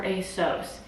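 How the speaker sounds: "asos" with a long o, like ay-sohs; American-style asos. Shown as a demonstration. Offering viewers the other option, "asos" as ay-sohs. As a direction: say "asos" to rhyme its end with "gross". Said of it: "am I supposed to say asos".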